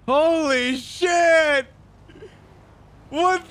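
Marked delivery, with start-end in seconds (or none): none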